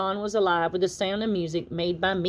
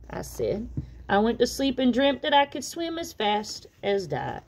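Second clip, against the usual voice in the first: plain reading voice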